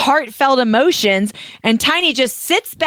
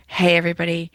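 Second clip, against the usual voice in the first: lower, softer casual toast voice; lightly voiced Tiny, lower and softer; imitating Tiny's speech; 7 semitones lower, 5 dB quieter, faster than usual. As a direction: mimic a laid-back guy thanking the crowd. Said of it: "And he's like".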